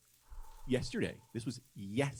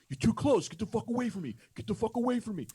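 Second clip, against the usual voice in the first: high, agitated mock yell; retold yelling; 9 semitones higher, 4 dB louder than usual. Neighbor to the guy behind him, high retold yelling. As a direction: yell like someone wanting space, pitched well up.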